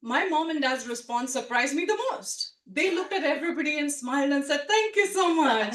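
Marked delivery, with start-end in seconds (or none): 4.7-5.7 higher, louder, bright thank-you; beaming thanks. Retold or imitated. Parents thank their guests, higher and louder, beaming.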